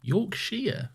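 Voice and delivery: Yorkshire echoed back questioningly with a "sheer" ending; queried pronunciation. Retold or imitated. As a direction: repeat Yorkshire as "york-sheer", querying it. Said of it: "you said it like an American saying it".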